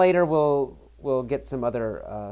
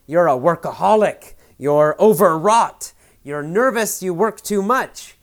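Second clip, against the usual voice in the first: louder, higher, half-joking scolding; mock-stern boss, a little louder and higher; 5 semitones higher, 8 dB louder than usual. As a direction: tease her like a boss chewing her out for fun. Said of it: "he's kind of criticizing her, eh, joking, not really serious".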